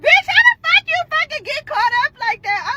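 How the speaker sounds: high-pitched teasing disbelief; imitating incredulous friend. Best friend asks how she got caught, high mock disbelief. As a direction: screech the question like a shocked friend.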